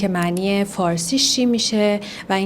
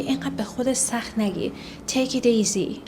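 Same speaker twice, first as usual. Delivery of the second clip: whisper; 3 semitones higher, 5 dB quieter than usual. Shown as a demonstration. Gently telling someone not to worry.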